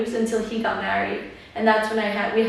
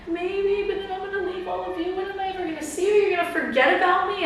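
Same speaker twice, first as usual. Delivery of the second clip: high, fast, tearful rush of worries; mock tearful rush; 9 semitones higher than usual. Telling family she'll miss them, high fast tearful pleading. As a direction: blurt the worries high and quick, near tears.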